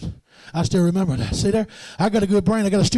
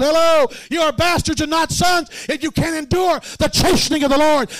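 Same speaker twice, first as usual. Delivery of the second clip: high, loud preacher's shout building; full-force preaching; 10 semitones higher, 6 dB louder than usual. Acted. Preacher rousing the saints, loud and high. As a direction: preach it out with rising fire.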